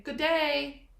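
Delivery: a light Australian "Good day"; Australian accent. Pretend. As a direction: try a cheery Australian greeting. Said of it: "Sound like I'm from Ir-, Australia".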